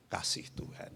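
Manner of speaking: breathy whispered God's love; hushed whisper. Real, not acted. Preacher hushes for God's love. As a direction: breathe it in awe.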